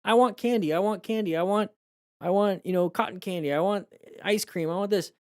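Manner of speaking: put-on very high, whiny kid begging for sweets; whining child. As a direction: nag like a kid in a candy shop. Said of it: "a child's like".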